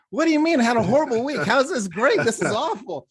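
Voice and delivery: high, loud, indignant client imitation; mock outrage. Client to therapist who called her week great, mock outrage. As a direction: squawk in hammed-up disbelief.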